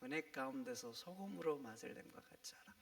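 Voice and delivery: 은혜 가운데서 소금으로 맛을 냄과 같이 하라 whispered softly; whispered verse. Shown as a demonstration. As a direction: repeat the verse in a hushed whisper. (속삭이듯 다시 읽는 말씀)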